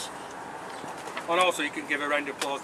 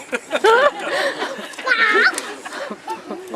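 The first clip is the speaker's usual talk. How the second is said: laughing; 4 dB louder than usual. Real, not acted. Laughs at heckle.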